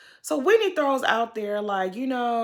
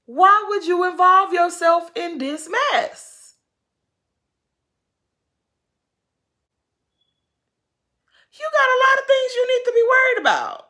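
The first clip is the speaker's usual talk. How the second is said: high, loud exasperated yell; raised, loud scolding at the screen; scolding shout; 8 semitones higher, 8 dB louder than usual. Real, not acted. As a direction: yell at her to mind her business. Scolding Whitney over drama.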